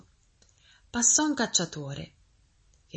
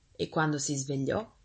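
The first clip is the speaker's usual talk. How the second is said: plain reading voice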